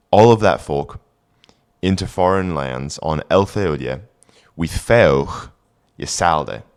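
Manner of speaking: put-on careful Old English recitation. Shown as a demonstration. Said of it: "reading Old English text aloud".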